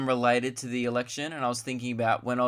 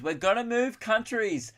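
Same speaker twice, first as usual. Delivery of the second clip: high-pitched mock vow; mocking declaration; 9 semitones higher than usual. Celebrities posting on social media, mocked in high pitch. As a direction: mimic them in a much higher voice.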